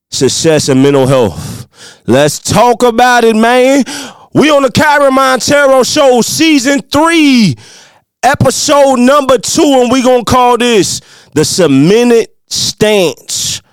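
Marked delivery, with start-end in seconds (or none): 2.1-13.6 high, revved-up podcast host announcing the show; hype show intro. Acted. Host hyping his podcast to listeners, high energetic announcer. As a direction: pump up the crowd like an MC. Hypes the show's opening.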